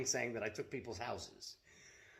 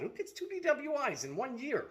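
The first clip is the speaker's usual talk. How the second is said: retold disbelief; 13 semitones higher than usual. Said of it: "I'm like".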